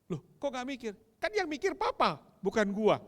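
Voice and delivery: a son's cheeky high comeback. Retold or imitated. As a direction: toss it off like a smug teenager. Son's cheeky reply retold.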